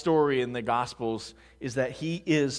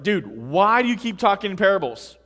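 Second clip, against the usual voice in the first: higher, louder imitated complaint; mock exasperation; 5 semitones higher, 8 dB louder than usual. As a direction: play a baffled follower pleading for straight talk. Disciples' complaint voiced by preacher.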